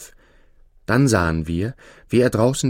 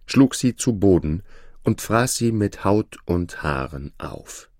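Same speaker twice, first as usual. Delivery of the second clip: plain reading voice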